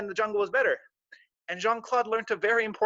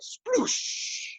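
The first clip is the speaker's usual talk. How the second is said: breathy high sploosh; splash sound. Acted. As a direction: make the splash noise. Falls into bucket.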